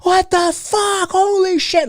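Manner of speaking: high, slow mock yell; retold yelling. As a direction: yell it in shock like a startled cop.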